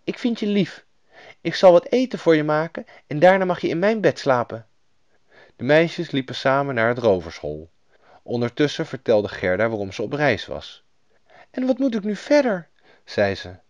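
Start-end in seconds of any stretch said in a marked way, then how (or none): 0.0-4.7 put-on high-pitched, friendly child; affectionate robber girl in a high voice; little robber girl. A narrator's reading. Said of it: "een aardig klein roversmeisje". 11.5-12.7 put-on high, troubled little girl's voice; young girl at a loss; girl heroine. A narrator's reading. Lost Gerda asks for help.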